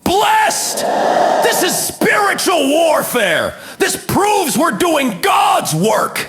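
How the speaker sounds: high, drawn-out mock shouting; slow, hammed-up triumphant yell; Stephen. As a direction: scream it like a zealous preacher. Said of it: "And Stephen stands there going".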